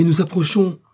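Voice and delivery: high, loud warning cry. A narrator's reading. Joe warns again as the crest nears, crying out. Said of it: "s'écria Joe".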